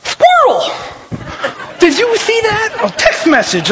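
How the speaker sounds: high, squeaky kid suddenly distracted. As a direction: blurt it in a very high put-on voice. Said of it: "Kids like changes in voices".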